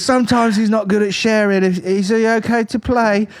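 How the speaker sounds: high mock Essex mum, slowed; imitating a posh-ish Essex mother. Essex mum asking if her son can play: high mock Essex voice. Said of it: "he goes".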